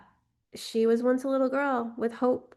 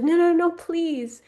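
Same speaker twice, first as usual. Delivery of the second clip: high, loud, slowed begging; desperate pleading; 7 semitones higher, 7 dB louder, slower than usual. Acted. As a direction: beg her not to leave, voice straining. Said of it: "She pleaded with her, more desperate this time".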